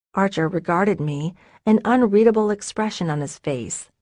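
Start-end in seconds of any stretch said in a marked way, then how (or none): none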